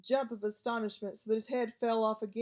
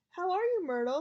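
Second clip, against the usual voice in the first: higher, forced cheerful greeting; falsely bright; 7 semitones higher, 4 dB louder than usual. A narrator's reading. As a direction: paste on fake brightness. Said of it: "in a falsely bright voice".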